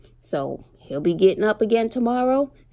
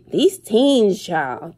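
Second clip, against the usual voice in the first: ordinary delivery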